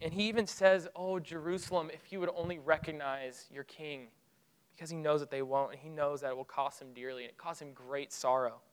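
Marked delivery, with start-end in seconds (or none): 1.0-4.1 soft, sorrowful sigh of a lament, much softer; grieving lament. Retold or imitated. Preacher voicing Jesus weeping over Jerusalem, soft sorrow. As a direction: let it ache quietly, a lament over the city. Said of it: "he begins to weep loudly".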